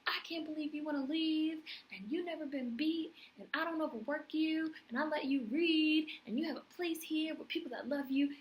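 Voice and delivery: wounded slave owner. Retold or imitated. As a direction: play the offended master who can't believe she'd leave. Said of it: "And he's like".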